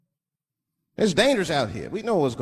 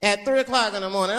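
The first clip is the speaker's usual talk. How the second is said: ordinary talk, nothing marked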